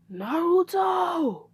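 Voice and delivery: high, drawn-out scolding call; scolding yell. Acted. Angry mother calls out her son, scolding yell. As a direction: stretch the name out in exasperation. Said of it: "Tsunade got a tick mark on".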